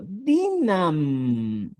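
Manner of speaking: "Dinam" drawn out slowly to show the validator; emphasized form. Shown as a demonstration. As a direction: stretch "Dinam" and land on the final m. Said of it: "En vez de Dina, digo".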